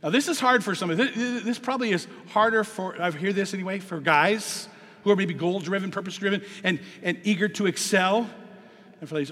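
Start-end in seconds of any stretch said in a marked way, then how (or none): none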